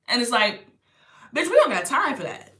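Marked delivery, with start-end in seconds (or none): 1.3-2.6 sassy pep talk. Pretend.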